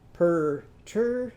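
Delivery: "Per-tur-tur" slowly, syllables apart; "er" in every syllable. Shown as a demonstration. Teacher renames Irving's potato, "Per-tur-tur" slow and separated. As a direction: draw out each "er" in "Per-tur-tur". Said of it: "what's it gonna be called?".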